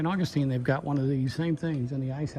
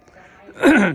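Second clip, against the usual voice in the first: loud sharp throat clear; 9 semitones higher, 15 dB louder than usual. Real, not acted. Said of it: "I'm wheezing a little bit".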